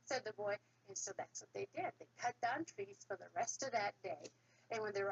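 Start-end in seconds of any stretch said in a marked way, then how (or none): none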